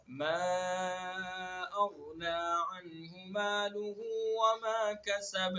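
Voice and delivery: very slow, drawn-out chanted recitation; tajweed chant; Quranic recitation. Acted. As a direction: recite solemnly, stretching every vowel. Recites the verse.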